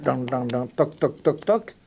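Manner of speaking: mimicking knocks on a door; tap-tap knocking noises, rising at the end; knocking sound. Shown as a demonstration. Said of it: "If you knock".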